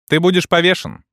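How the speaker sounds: loud, high, instant sentence; flat loud verdict. A narrator's reading. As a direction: snap back the verdict. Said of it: "не задумываясь".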